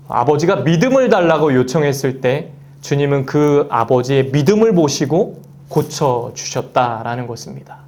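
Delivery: ordinary delivery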